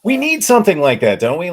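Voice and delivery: high, loud mock-earnest; sarcastic, over the top. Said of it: "Like, I'm being sarcastic".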